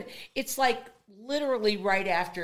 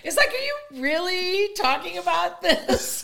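laughing disbelief; 10 semitones higher, 3 dB louder than usual. Real, not acted. Incredulous laughing remark.

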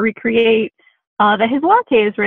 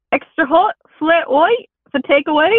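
slow, higher-pitched attempt at Australian; Australian accent; 5 semitones higher than usual. Shown as a demonstration. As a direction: call it out in a shaky-quality amateur Australian accent. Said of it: "with an Australian accent".

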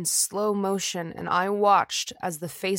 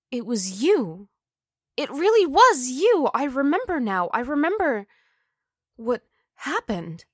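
horrified realization; 5 semitones higher, 3 dB louder than usual. Acted.

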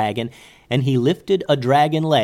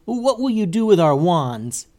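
higher-pitched wizard's demand; 6 semitones higher, 3 dB louder than usual. A narrator's reading. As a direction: ask it as a mildly indignant challenge. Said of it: "demanded one of the other wizards".

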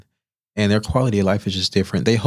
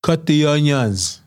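slow, high, deliberate chef order; stern chef; 6 semitones higher, much slower than usual. Retold or imitated. Head chef ordering the new cook around, slow and firm. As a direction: spell it out slowly like a bossy chef. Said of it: "He's like".

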